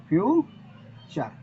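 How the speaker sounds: Future stretched slowly, syllable by syllable; future said very slowly and clearly; drawn-out model word. Shown as a demonstration. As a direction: drag out future for students to copy. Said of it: "ध्यान देना".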